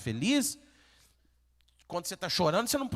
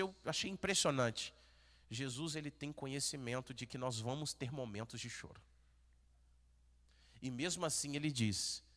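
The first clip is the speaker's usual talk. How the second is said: ordinary talk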